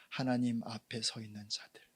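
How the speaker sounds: hushed preaching whisper. Acted. Pastor whispering the point to the congregation. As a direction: drop to an intimate whisper.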